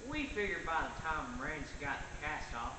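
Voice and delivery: ordinary delivery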